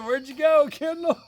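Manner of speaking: high, loud mock calling-out; teasing call. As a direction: holler after a fleeing kid to embarrass her. Dad calls after fleeing daughter.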